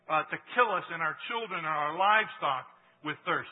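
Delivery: loud, fervent reading of the passage; preaching scripture reading. Acted. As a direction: proclaim the scripture to the congregation. Reads Exodus passage aloud.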